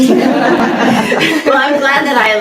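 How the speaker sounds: laughing out the words, high and loud; bubbling over with a real laugh mid-sentence. Real, not acted. Reacting to praise with laughter.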